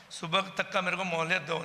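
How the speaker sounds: normal talking voice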